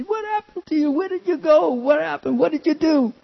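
high, anxious mother's questions; high, rushed questions of a relieved mother; worried mother. Retold or imitated. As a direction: play the frantic mom finding her child. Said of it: "and she".

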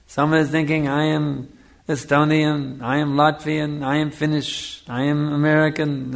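normal talking voice

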